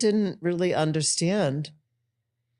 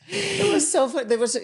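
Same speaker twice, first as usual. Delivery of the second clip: much higher, laughing lightly; 10 semitones higher than usual. Real, not acted. Host recalling the early days, laughing. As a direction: giggle through it.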